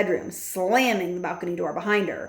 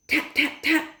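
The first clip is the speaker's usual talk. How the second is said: higher, crisp tap tap tap; crisp voiced taps; 8 semitones higher than usual. A narrator's reading. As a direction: mimic a bottle knocking. Knocking sounds voiced.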